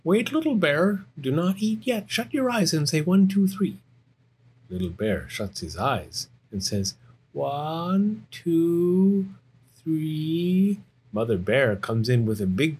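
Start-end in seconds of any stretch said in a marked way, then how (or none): 0.0-3.8 high, insistent storybook cat; urgent Cat. Acted. Cat stopping Little Bear from eating, high and urgent. 7.3-10.8 put-on high, drawn-out child's count; slow suspenseful counting. Little Bear counting with eyes shut, high and drawn out.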